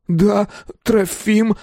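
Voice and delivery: slow, high, hesitant answer; stumbling admission. A narrator's reading. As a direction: falter on the name as if tripping. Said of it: "будто споткнувшись".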